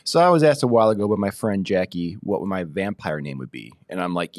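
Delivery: ordinary talk, nothing marked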